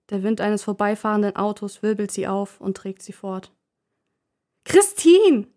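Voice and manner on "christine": high, loud angry call of a name; mother yelling across the street at her daughter; angry shout. Acted. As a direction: yell for your daughter, furious. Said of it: "schreit eine aufgebrachte Frau".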